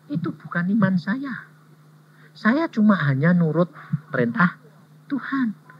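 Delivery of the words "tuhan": ordinary talk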